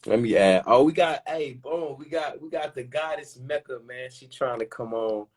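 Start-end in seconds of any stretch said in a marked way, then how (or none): none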